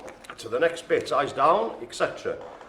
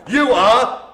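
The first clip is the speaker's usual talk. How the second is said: excited announcement; 7 semitones higher, 9 dB louder than usual. Real, not acted. Announcing winner, correct answer.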